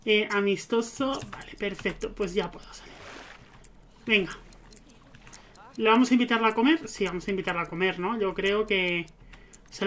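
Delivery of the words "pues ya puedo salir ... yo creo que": ordinary talk, nothing marked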